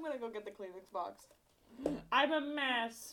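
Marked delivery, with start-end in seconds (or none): 2.1-3.1 slow, drawn-out self-pity; slow lament. Real, not acted. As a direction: moan it slowly. Sneezing, swollen from allergies.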